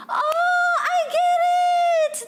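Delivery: high-pitched dawning realization; sudden realization. Real, not acted. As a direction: squeal it as the joke finally lands. Suddenly gets the chat's joke.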